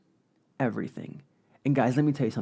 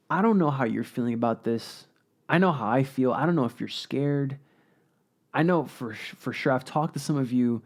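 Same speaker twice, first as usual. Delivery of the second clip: normal talking voice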